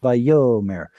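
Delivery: "Vayomer" stressed on middle YO. Shown as a demonstration. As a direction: lean into yo, light final mer in "Vayomer". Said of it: "the yod starts the stress syllable".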